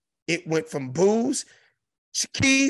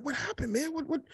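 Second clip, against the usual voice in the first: hushed, higher aside said close in; imitating a whisper; 11 semitones higher, 7 dB quieter than usual. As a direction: lean in and murmur it as if to a friend. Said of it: "He whispered in my".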